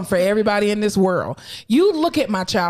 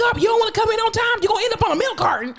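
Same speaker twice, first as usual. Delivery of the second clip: mock shouted warning to a child; mock parent yell; 11 semitones higher, faster than usual. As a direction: yell it like a fed-up mom. Parent threat quoted to child.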